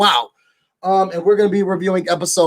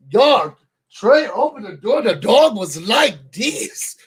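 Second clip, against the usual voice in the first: ordinary talk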